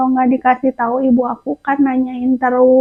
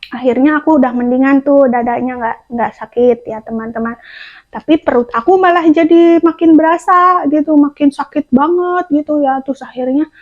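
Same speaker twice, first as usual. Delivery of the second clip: ordinary delivery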